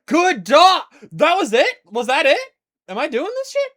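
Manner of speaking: high, loud and giddy; excited squeal. Real, not acted. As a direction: squeal with delight. Praising dog, excited squeals.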